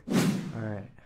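ordinary talk

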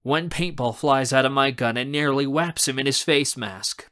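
ordinary delivery

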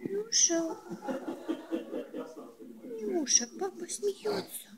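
very high squeaky name call. Real, not acted. Calling the pet. (писклявый зов)

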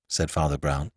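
ordinary delivery

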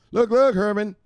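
high hype-man call; high, punchy greeting; hyped shout-out. Pretend. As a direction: sing out the name up high. Calling out a follower.

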